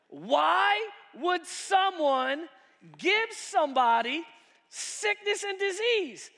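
high, slow, loud outrage at the gift; outraged shout. Acted. Preacher shouting at a gift of disease, high and slow. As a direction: preach it like an insult.

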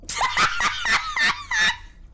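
shrieking high laughter. Real, not acted. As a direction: burst out laughing. Laughing at the character's face.

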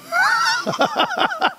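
shrill put-on laugh; hammed-up high laugh; high mocking cackle. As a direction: cackle at the offer. Laughter in joke.

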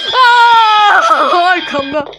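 high, loud shriek of terror; terrified scream. Real, not acted. Player caught from behind, screaming in terror. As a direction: scream in real fright. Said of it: "Salak gibi gülüyorum".

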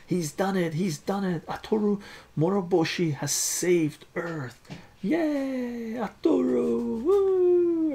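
high, loud, excited cheering; cheering crowd. A narrator's reading. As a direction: whoop and cheer like a crowd celebrating a hero. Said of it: "Everyone's cheering".

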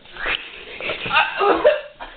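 voiceless wheezing laugh; laughing without voice; breathless high laugh. Real, not acted. After the cinnamon challenge.

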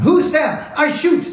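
high, loud youthful call into the dark; shouted challenge. A narrator's reading. Guard shouting at a stranger in the night, high and loud. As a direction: call out a sharp warning. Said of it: "hörte er eine jugendliche Stimme rufen".

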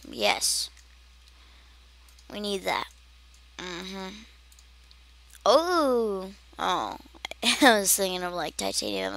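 ordinary talk

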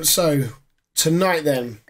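normal talking voice

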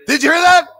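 high, loud, rushed disbelief; shocked. Real, not acted. Ghost hunter asks his viewers right after the voice, shocked and loud. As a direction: blurt it out, still jolted. Startled again by the replay.

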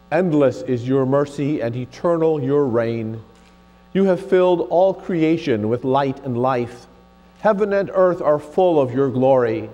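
normal talking voice

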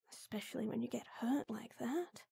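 tender whispered worry; soft worried whisper. Acted. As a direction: drop to a hushed, worried whisper.

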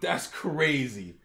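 plain reading voice

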